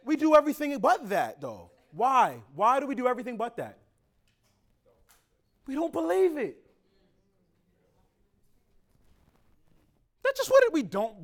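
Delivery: loud, high preacher's cry; preaching in bursts; full-force preaching. Acted. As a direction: call it out to the congregation.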